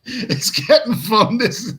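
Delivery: delighted laughing remark. Real, not acted. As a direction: giggle your way through it. Laughing at the chat comments.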